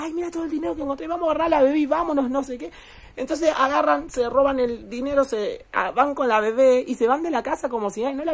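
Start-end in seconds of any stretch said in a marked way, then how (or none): 0.0-2.7 fast, higher, hushed excitement; excited whisper-like rush. Retold or imitated. A son showing his partner the stolen money, excited and fast. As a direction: rush it out, thrilled with the loot. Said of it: "le dijo a su pareja".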